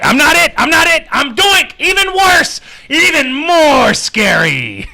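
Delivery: high squeaky mock voice, slow at the end; mock scared kid. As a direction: mimic a frightened child, hammed up. Mocking a scared kid's voice.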